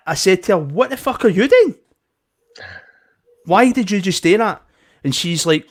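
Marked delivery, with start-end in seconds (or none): 0.6-4.6 angry confrontation. Retold or imitated. Retold confronting the staff member.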